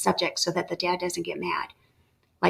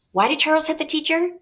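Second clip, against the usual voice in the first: fast, higher question; quickly asked; 7 semitones higher, faster than usual. Acted. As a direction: rush it to change the subject. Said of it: "She quickly asked that question".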